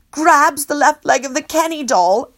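ordinary delivery

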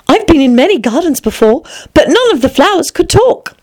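put-on high-pitched questioning girl; young Alice. Alice questioning the flowers, high girlish voice.

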